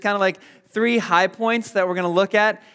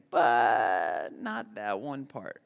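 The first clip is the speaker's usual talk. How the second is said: slow, lower, quieter hedge; reluctant; 8 semitones lower, 6 dB quieter, much slower than usual. Retold or imitated. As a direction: hold back, drawn out and grudging. Imitating a hedging believer.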